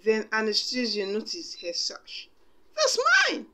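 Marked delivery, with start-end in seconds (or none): 2.8-3.5 very high, loud bratty yell; snatch it back, shrieking. A narrator's reading. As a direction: stepsister claiming her sash in a high yell. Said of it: "she yelled".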